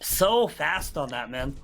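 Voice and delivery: lively live match call; esports caster. Real, not acted. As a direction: narrate the action live. Live play-by-play commentary.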